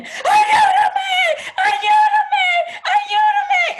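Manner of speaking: imitating a screaming toddler, very high and loud. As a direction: shriek it out like a panicked little kid. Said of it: "started screaming".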